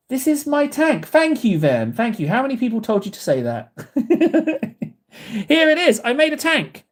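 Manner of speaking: high delighted thank-yous; excited. Real, not acted. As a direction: gush with joy at praise. Reacting to compliments excitedly.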